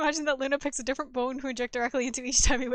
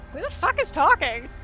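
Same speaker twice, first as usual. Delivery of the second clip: loud, higher, baffled demand; startled outburst; 3 semitones higher, 5 dB louder than usual. Real, not acted. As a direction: thrown by an unknown voice, louder and higher. Startled by unexpected game voice.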